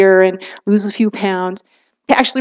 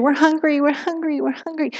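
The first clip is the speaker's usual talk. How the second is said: mock whining children, higher and fading softer; whiny kids; 6 semitones higher, 7 dB quieter than usual. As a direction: lightly whine like hungry kids. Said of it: "your kids are yelling at you".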